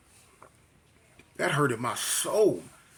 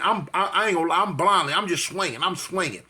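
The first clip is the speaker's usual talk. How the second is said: normal talking voice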